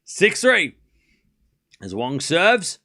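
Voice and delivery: live tennis commentary, rising high on big points; play-by-play call. Acted. Streamer calling the tiebreak for chat, live play-by-play. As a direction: narrate every shot as it lands.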